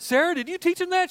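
husband teasing his wife, mock accusing; accusing question. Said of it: "I said".

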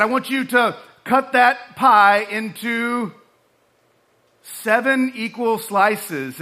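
slow, drawn-out order; slow command. Retold or imitated. Squad leader ordering a cadet, slow and deliberate. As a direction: spell out each word of the order. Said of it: "he said".

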